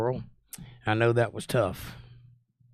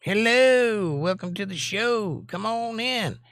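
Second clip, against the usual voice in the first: excited greeting; 10 semitones higher, slower than usual. Real, not acted. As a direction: greet the audience big. Host opens live show.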